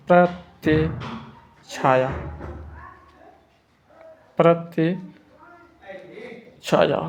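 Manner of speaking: प्रतिछाया stretched out very slowly, each syllable held; teacher dictating प्रतिछाया at a crawl; very slow dictation. Shown as a demonstration. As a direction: drag out प्रतिछाया so students can write it down. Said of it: "प्रतिछाया से लिखेंगे".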